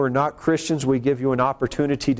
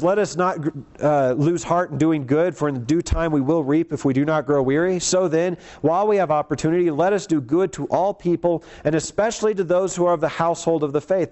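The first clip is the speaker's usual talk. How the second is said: higher-pitched, earnest reading of the verse; raised scripture reading; 4 semitones higher than usual. Acted. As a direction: read the passage out to the congregation, pitched up. Reading Galatians passage aloud.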